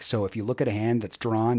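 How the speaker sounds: ordinary delivery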